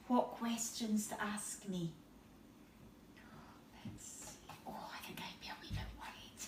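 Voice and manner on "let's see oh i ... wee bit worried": soft quick whisper; whispered aside. Real, not acted. Reader confiding to the child, whispering.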